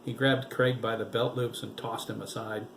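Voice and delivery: usual speaking voice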